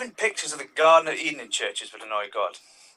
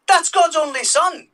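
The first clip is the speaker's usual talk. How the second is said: mock reverent; 12 semitones higher, 7 dB louder, slower than usual. Stand-up punchline about God.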